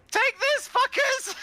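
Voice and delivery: very high-pitched attack yell; mock battle cry. Player acting out the attack on the ship, high mock battle cry. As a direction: hurl a squealed, playful taunt at the enemy. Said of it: "I was gonna be like".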